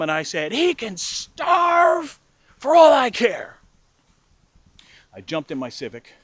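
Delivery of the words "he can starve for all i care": loud, high, drawn-out yell; acted spiteful shout; furious retort. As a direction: yell a parting shot, stretching it out. Furious parting shot at mom.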